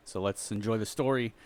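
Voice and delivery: usual speaking voice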